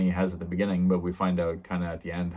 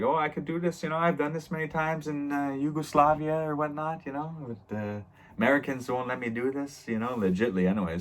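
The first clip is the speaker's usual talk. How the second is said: high, sly back-alley doctor; shady surgeon voice; 7 semitones higher than usual. Retold or imitated. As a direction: pitch it up like a shifty surgeon selling a secret. Shady surgeon pitching illegal surgery.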